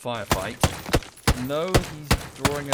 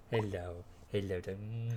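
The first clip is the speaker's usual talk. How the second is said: cooing to dog; 6 semitones lower, 10 dB quieter, slower than usual. Real, not acted. Owner coos softly to her dog, offering cuddles. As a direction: coo softly like greeting a puppy.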